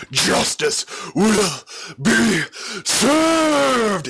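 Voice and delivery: slow, high, drawn-out yell rising to a peak; triumphant yell. A narrator's reading. Villain's victorious cry at the climax, a slow high yell. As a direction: bellow it out in triumph. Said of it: "he yelled".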